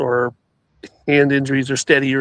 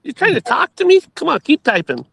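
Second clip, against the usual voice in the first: playful high-pitched "what's that now"; mock indignant; 13 semitones higher than usual. Real, not acted. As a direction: tease him in high playful outrage. Teasing co-host on stream.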